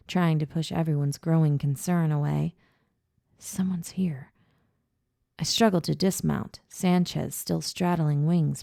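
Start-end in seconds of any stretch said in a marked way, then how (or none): 3.4-4.3 tense unvoiced whisper. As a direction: breathe the warning. Sensing a hidden presence.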